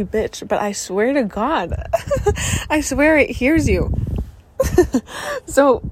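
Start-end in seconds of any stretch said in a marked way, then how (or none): 1.8-2.6 breathy high giggle at herself; giggle, embarrassed at sounding crazy; high laugh. Real, not acted. Girl laughing at her own crazy-sounding claim, high giggle. 4.6-5.4 light high giggle; high laugh. Real, not acted. Girl giggling after swearing the universe hears, high giggle.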